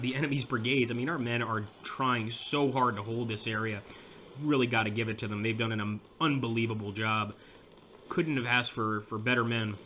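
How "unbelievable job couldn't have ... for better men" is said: usual speaking voice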